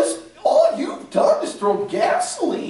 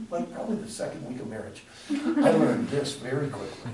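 ordinary talk

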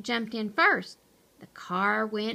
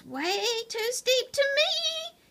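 high, thin squeaky voice; small squeaky rodent worry; nervous squeak; 14 semitones higher than usual. A narrator's reading. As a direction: squeak it out, pitched right up. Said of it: "he squeaked".

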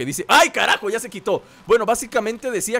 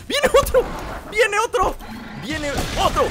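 high alarmed shout that settles down; 12 semitones higher than usual. Real, not acted. Gamer seeing another robot coming, shouting in alarm. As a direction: warn yourself as another one charges.

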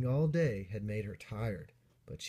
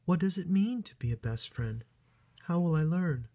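put-on higher, curious young bunny voice; wondering storybook heroine, pitched up; questioning little bunny; 8 semitones higher, 4 dB louder than usual. A narrator's reading. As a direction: ask it like an eager small bunny. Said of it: "questioned Bot".

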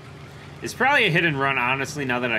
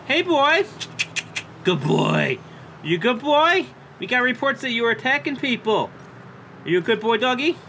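much higher, sing-song pet talk; cooing at a dog; 9 semitones higher than usual. Real, not acted. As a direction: gush over the husky like a puppy lover. Cooing at a husky.

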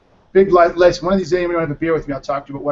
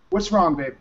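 soothing father; 3 semitones higher, 3 dB quieter than usual. Real, not acted. Father soothing his crying youngest daughter, gentle and lifted. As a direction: soothe her softly, pitch lifted.

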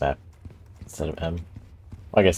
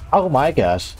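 startled, higher and louder; real jump scare, blurting it out; scared horror game streamer; 9 semitones higher, 6 dB louder, slower than usual. Real, not acted. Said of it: "That scared the shit out of me".

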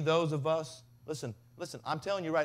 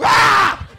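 sudden high piercing shriek, much louder; startled scream; 21 dB louder than usual. Retold or imitated. Parent woken by kid staring, shrieking scream. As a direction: jolt awake screaming in fright. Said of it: "you wake up like".

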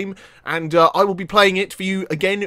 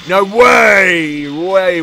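shout; 7 dB louder, much slower than usual. Real, not acted. Shouting at obstacle.

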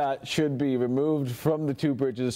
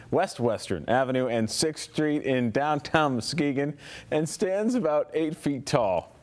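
normal talking voice

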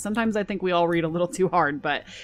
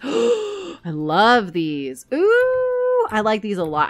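excited; 4 semitones higher, 5 dB louder, much slower than usual. Real, not acted. Delighted by new items.

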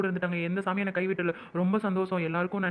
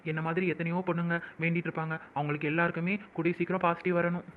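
ordinary talk, nothing marked

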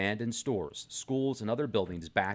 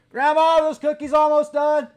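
put-on much higher, loud childish whine; whiny grandkid voice; 13 dB louder than usual. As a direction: squeal it like a kid waiting on cookies. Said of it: "this is what the senator says".

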